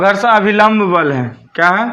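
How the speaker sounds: ordinary talk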